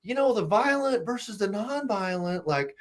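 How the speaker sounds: high, mincing parody of the critics; mocking these people. Retelling the critics he's sick of, high mocking whine. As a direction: sneer at their hand-wringing in a high prissy voice. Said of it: "you had these people".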